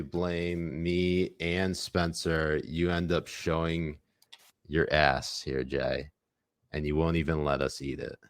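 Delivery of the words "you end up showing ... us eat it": ordinary talk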